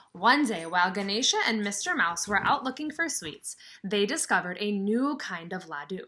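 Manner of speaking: lively storybook reading; reading to children. Acted. Teacher reading a picture book to her class, lively storybook voice. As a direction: read it out to the kids with some sparkle.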